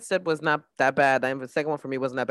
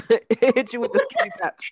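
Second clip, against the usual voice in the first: laughing; 8 semitones higher than usual. Retold or imitated. Echoing the cat voiceover, laughing.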